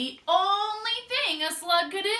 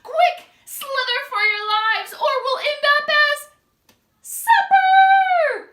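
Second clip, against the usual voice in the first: louder, rising frantic urging; panicked plea; 11 semitones higher, 11 dB louder than usual. Acted. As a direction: hurry them in terror. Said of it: "in panic".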